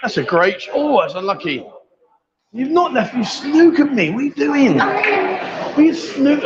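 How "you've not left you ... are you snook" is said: high-pitched, incredulous protest; playful outrage. Real, not acted. Player to opponent who snookered him, high incredulous protest. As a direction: protest in a raised, disbelieving pitch.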